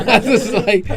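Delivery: high, loud laugh-talk. Real, not acted. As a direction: laugh as you say it. Laughing mid-sentence.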